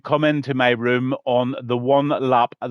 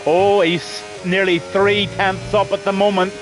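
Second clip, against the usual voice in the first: live race commentary; 5 semitones higher than usual. Real, not acted.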